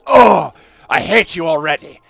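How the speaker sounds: a loud, high, haughty put-down; snooty hostile reply. Pretend. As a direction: sneer it at the rude Romans. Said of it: "And they're like".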